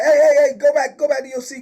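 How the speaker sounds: loud, high, fast retold order to sit back down; imitating a desk officer yelling across the room; retold shout. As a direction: bark it like a guard stopping a man. Desk guard ordering inmate back.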